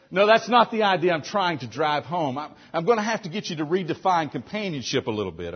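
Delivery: ordinary delivery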